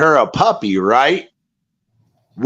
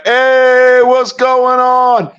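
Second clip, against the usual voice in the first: greeting shout; 8 semitones higher, 4 dB louder, much slower than usual. Retold or imitated. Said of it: "I was like".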